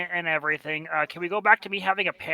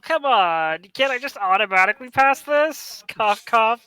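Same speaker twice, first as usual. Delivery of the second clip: mock pleading; 6 semitones higher, 5 dB louder than usual. Player fakes sickness to pass roll.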